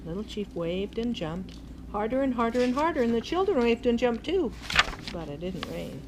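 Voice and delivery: ordinary delivery, nothing marked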